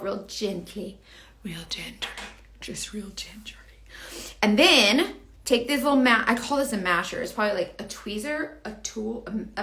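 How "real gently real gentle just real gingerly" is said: very soft, trailing into a whisper; hushed and gentle. Real, not acted. Showing viewers how to pull a lash out gently, hushed. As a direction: drop gradually to a careful whisper. Demonstrating a delicate, careful pull.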